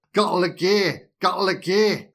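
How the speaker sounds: ventriloquist-style delivery, loud and high; mock a mouth that can't quite say the line; Dan. Co-host mocking Dan's garbled lines, loud ventriloquist impression. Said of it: "delivering lines like he's a ventriloquist".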